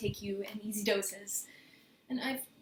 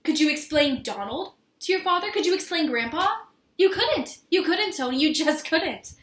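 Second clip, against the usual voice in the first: loud, higher, insistent challenge; upset outburst; 4 semitones higher, 8 dB louder than usual. Acted. To Tony about explaining her family, upset and insistent. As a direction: hammer the questions home, frustrated. Argues they can't marry.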